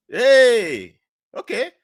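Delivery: loud, high celebratory holler; drawn-out, high-pitched hooray; mock cheer. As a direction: cheer it out like a winner. Mock cheer at winning a scholarship.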